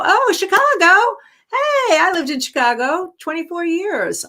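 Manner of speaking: light up at the name of the city; excited about Chicago. Real, not acted. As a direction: voice jumps way up, delighted. Host reacts to caller's city.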